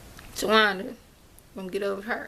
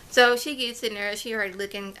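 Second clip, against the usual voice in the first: usual speaking voice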